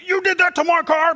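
mock angry dad. Father scolding son over car, mock anger. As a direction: play the dad snapping at first.

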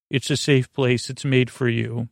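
ordinary talk

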